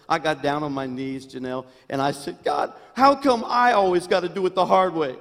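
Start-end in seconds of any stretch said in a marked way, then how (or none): 2.4-5.2 high, loud, fast hammed-up whine; mock whiny prayer. Pastor mocking his own prayer to God, hammed-up loud whine. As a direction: whine to God like a spoiled child, tongue in cheek. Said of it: "I'm just kidding".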